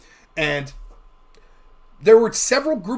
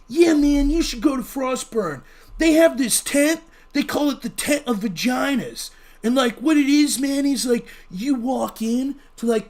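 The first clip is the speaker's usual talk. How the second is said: fake hippie; 3 semitones higher than usual. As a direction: sell it like a blissed-out hippie. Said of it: "my fake hippie voice there".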